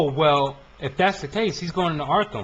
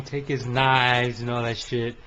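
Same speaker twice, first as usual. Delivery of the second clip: ordinary talk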